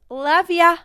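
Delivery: loud. Pretend. Sign-off called out.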